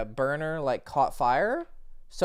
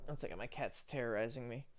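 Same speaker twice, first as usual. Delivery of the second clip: usual speaking voice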